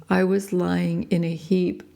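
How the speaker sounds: plain reading voice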